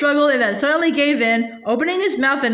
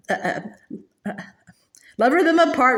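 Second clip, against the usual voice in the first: ordinary delivery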